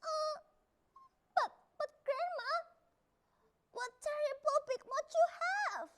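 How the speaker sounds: put-on high, stammering child voice; a frightened girl asking; high fearful child voice; little girl voice. A narrator's reading. Child questions the wolf.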